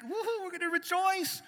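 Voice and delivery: high, loud joyful yell; father yelling in delight over his daughter's news; excited shout. Retold or imitated. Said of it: "It energized me".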